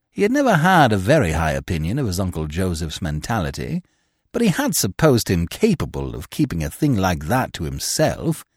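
plain reading voice

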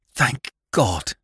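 a gasp of relief, breath caught. Acted. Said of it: "I gasped out".

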